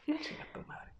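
whispered joking curse. Real, not acted. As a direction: hiss it out under your breath, joking. Joking insult under breath. (susurrando en broma)

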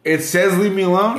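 incredulous. Real, not acted. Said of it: "incredulous question".

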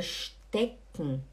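"stecken" as SHTEK-n, no vowel before the n; swallowed final E. Shown as a demonstration. Teacher introducing the word to students, swallowed ending. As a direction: drop the E in "stecken", closing straight onto n. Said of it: "como se o E você não falasse".

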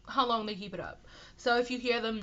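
ordinary talk

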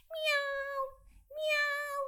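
very high meowing kitty; two high, drawn meows; cat meow. Acted. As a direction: meow like a small cat calling. Cat calls the rooster.

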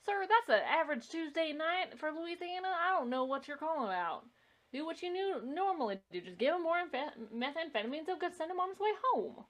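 mock a bored Louisiana police dispatcher in a bad Southern accent. A dispatcher shrugging off a murder call, bad Southern accent. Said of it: "That was my very bad Southern accent".

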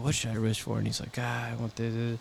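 low, soft musing; thinking aloud. Acted. Man pondering his one wish from the genie, quiet musing. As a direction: mull it over under your breath. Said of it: "he's like, and he's thinking".

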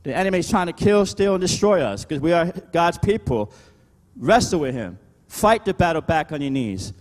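high, driving pulpit delivery; emphatic preacher's rallying call; preaching. Real, not acted. Preaches at full force.

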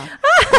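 loud, high laugh; bright laughing outburst; high burst of laughter. Real, not acted. Laughing at own joke.

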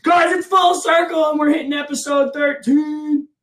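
loud, high announcer intro; hype podcast intro. Acted. Host opening the show for listeners, hyped announcer voice. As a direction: belt it out like a show opener. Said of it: "podcast intro announcement".

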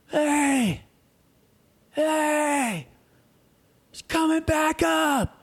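high, drawn-out holler; imitated distant call. Diver hollering a warning to his swimming friends, high drawn-out call. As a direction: call long and high, as if across water.

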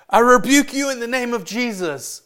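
high, loud preacher-style rebuke; hammed-up shouted rebuke; mock stern rebuke. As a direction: cry it out like casting out evil. Acting out a confrontational rebuke.

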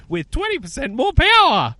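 high, loud hyped-up ad parody; mock sales pitch. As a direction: imitate an overexcited car pitch, pushing up high. Mimics car company sales pitch.

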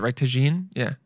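ordinary talk, nothing marked

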